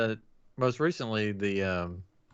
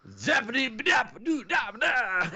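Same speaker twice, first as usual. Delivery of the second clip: high, shouted scat riff; 10 semitones higher, slower than usual. Acted. Showing the actor playing the villain, shouted scat impression. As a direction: belt out slow, high nonsense syllables.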